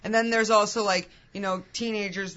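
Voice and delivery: ordinary talk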